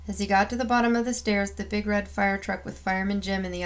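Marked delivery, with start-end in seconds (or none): none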